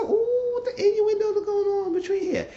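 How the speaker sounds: very high squealed oohing; scandalized reaction. Retold or imitated. Viewer reacting to innuendos between characters, mock scandalized. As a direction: squeal it like juicy gossip.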